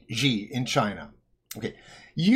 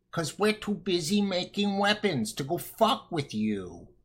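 put-on old woman voice; 2 semitones higher, 5 dB quieter than usual. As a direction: croak it like a frail old lady. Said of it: "she says in her old ... voice".

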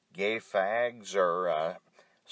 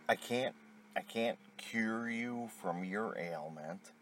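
normal talking voice

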